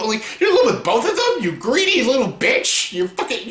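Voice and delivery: can't believe what she just said; incredulous outburst. Real, not acted. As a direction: call her out in disbelief. Incredulous rant at Bella.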